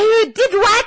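high, loud outraged squeal; a teacher's stunned, high-pitched outrage; shocked disbelief. Retold or imitated. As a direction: gasp it out like you can't believe it. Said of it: "Teacher Maria is equally upset".

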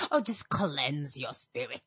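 soft, low, slow soothing elder; acted grandmother, hushed and unhurried; gentle grandmother. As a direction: soothe softly, drawn out. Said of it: "apparently, they just bathe us in herbs".

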